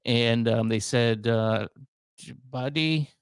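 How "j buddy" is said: halting mock Indian accent; tentative Indian-accent impression; Indian scammer accent. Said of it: "I'm not gonna do the accent".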